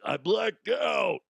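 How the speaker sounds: mock shout. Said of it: "And I'm gonna be like".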